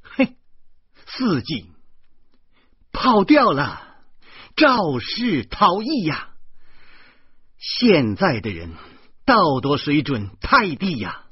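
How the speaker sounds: 越说越高越响的愤慨; 拍着桌子怒斥, 放慢加重; 义愤填膺. A narrator's reading. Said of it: "义愤地说". (诗谦拍桌子痛斥肇事司机)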